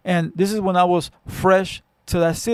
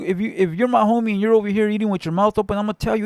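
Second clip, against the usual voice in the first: ordinary talk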